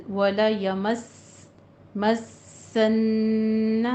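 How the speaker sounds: مَسَّنَّ slowly, each shadda held twice; doubled letters. Shown as a demonstration. Teacher drills the three shaddas in مَسَّنَّ, very slowly. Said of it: "تینوں جگہوں پہ تشدید آ رہی ہے".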